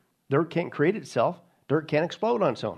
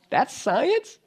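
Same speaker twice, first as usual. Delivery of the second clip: incredulous scoff; 9 semitones higher than usual. Real, not acted. Mocking the claim.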